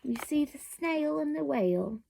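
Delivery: plain reading voice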